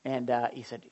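usual speaking voice

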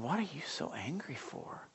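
whispered to himself, unvoiced; inner whisper. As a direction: hiss the question under your breath. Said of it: "And I'm thinking".

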